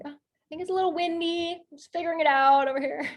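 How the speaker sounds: high, put-on casual voice; playing it cool. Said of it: "trying to play it kind of cool".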